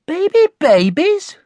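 high, loud, questioning echo; doubtful. A narrator's reading. Connie repeating Mum's news back, doubtful. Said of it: "didn't just sound doubtful now".

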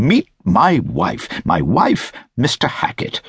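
high, eager exclamation; crying introduction. A narrator's reading. Gentleman introducing his wife to Mister Hackett, exclaiming eagerly. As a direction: present her with excited repeats. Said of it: "cried the gentleman".

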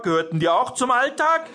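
high, loud indignant question, climbing; calling out. A narrator's reading. Jörg challenging a parent at the meeting, calling out. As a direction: fire it back as an outraged challenge. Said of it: "rief Jörg".

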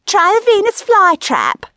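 high, loud retort; high-pitched comic shrew; nagging wife. A narrator's reading. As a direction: yell back as a scornful wife. Wife yells back in toilet quarrel.

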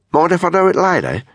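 high-pitched, broad Australian woman; reply in a strong Aussie twang; Australian accent, pitched well up; pronounced Australian accent. A narrator's reading. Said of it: "in a very pronounced Australian accent".

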